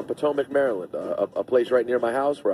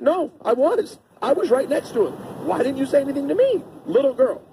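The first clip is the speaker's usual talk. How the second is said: put-on much higher childlike insistence; little girl insisting; 11 semitones higher than usual. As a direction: push back like a stubborn kid. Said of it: "She's like".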